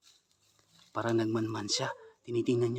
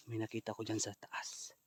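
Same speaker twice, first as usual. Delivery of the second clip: whispering; 5 semitones lower, 11 dB quieter than usual. Real, not acted. Ghost hunt, sneaking.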